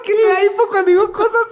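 high laughing voice; laughing helplessly. Real, not acted. Laughing about hiccups.

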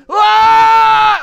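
high, very loud sustained shriek; sudden full-voiced eerie scream; imitated scream. Storyteller imitating the noise answering the prayers, loud scream. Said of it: "pretty soon it goes".

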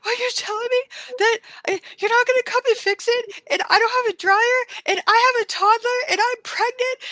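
high, fast, near-tearful pleading; a pregnant mother near tears on the phone; distraught pleading. Retold or imitated. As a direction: plead in panicked disbelief. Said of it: "I was just, I was like".

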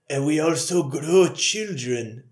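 slow, put-on Japanese accent; hammed-up Japanese-accented movie line; mock Japanese accent. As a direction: imitate a Japanese man's accented English, slowly. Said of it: "the Japanese guy's like".

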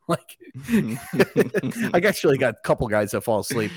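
said through a laugh. Real, not acted. Laughing about sleepy church guys.

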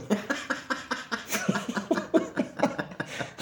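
high breathless giggling laugh; helpless laugh. Real, not acted. To a friend, laughing at being covered in soil. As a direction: laugh in disbelief at the mess.

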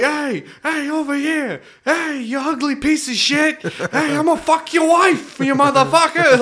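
put-on loud, high Brooklyn accent yelling; New York heckler shouting. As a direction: yell it like a Yankees fan heckling. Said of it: "the most New York way ever".

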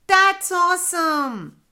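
high, loud thrilled cheer; high-pitched gleeful yell; kids shouting. A narrator's reading. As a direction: shout it out as delighted children. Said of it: "the two kids shouted".

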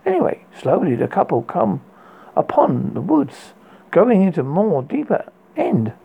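ordinary delivery, nothing marked

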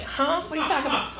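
soft, puzzled reply; baffled. Acted. Resident answers a stranger at the door, baffled. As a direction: answer under your voice, confused. Acts baffled at doorstep offer.

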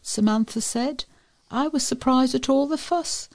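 ordinary delivery

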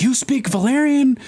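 high-pitched, incredulous imitation; incredulous question. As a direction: pitch leaps up in mock disbelief. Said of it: "he's like".

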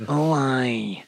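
low, slow mock Scottish accent; oppressive Scottish man. Imitating an oppressive Scotsman in a low Scottish accent. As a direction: drop into a drawn-out Scottish voice. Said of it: "then you roll into an oppressive Scottish man".

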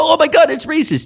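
high-pitched mocking imitation of an objector; mock outrage. Comic imitating people who cry racism, mock outrage.

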